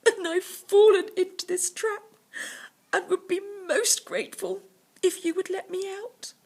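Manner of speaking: trapped tiger, overdone politeness; pleading tiger. Acted. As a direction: beg sweetly to be let out. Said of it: "the tiger said".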